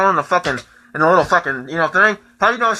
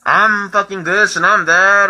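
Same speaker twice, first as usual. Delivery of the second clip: ordinary delivery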